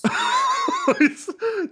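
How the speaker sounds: high, loud burst of laughter; big helpless laugh; laughing hard. Real, not acted. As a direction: crack up uncontrollably. Cracking up at rant video.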